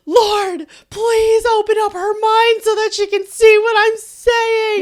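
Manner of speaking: high, loud re-enacted cry to God; hammed-up shouted plea to the Lord. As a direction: yell an exasperated prayer mid-argument. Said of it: "raising our voices o-, over the phone".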